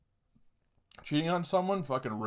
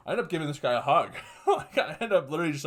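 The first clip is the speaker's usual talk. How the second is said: fast, higher and louder through laughter; laughing; 4 semitones higher, 5 dB louder, faster than usual. Real, not acted. Teller laughing about hugging the guy, fast laughter. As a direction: rush it out while laughing. Laughing while telling hug.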